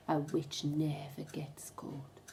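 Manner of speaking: a slow whispered secret; hushed warning. A narrator's reading. Storyteller whispering a warning to the children.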